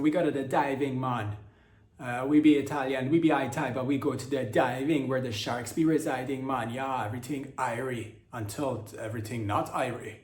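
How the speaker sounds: put-on Jamaican accent for Italian divers; mock Jamaican accent. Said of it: "picked up their Jamaican accent".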